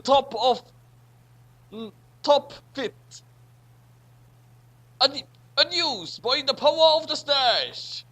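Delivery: ordinary talk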